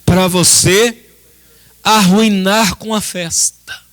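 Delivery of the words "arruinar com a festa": ordinary talk, nothing marked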